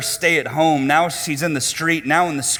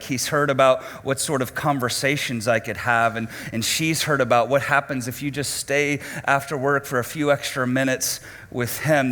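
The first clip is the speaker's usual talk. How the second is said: ordinary talk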